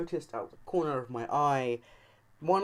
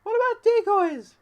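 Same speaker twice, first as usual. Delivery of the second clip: much higher, louder mocking kid voice; shouted out; 8 dB louder than usual. Annoying kid shouting to the gang, high loud mock squeal. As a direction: yell it in a shrill kid's squeal. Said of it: "some little bitch shouted out".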